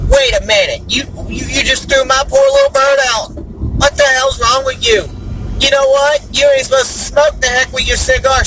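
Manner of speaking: high-pitched, indignant protest; mock outrage. Passenger rages at pilot over thrown-out bird, mock outrage.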